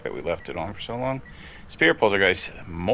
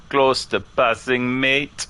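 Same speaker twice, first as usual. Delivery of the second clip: slow, higher, louder mock Jamaican accent; drawn-out imitation with a Jamaican lilt; vaguely Jamaican accent; 6 semitones higher, 5 dB louder, much slower than usual. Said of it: "Why did it sound vaguely Jamaican".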